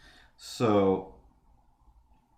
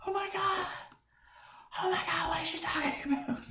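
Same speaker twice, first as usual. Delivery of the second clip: breathless whispered disbelief, high and hushed; shocked whisper; 7 dB quieter, faster than usual. Retold or imitated. Neighbor admits a killing, narrator whispers in shock. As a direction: gasp it under your breath, half laughing. Stunned reaction to neighbor's confession.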